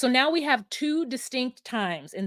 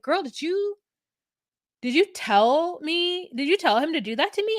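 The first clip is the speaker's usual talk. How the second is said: higher-pitched, halting disbelief; imitated confronting question; 7 semitones higher than usual. A D asking Laura what she told him, imitated confronting question. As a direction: act out questioning a friend point-blank.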